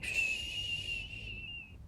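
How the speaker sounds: long soothing shh; hushing shh. Retold or imitated. Daughter hushing her anxious mom, soft long shh. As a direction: hush her gently like a child. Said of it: "and I was like".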